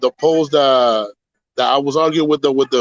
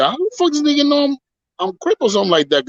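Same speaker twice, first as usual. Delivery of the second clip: quick, higher-pitched indignant question; fast, high mock outrage; retold disbelief; 12 semitones higher, faster than usual. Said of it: "He said, said".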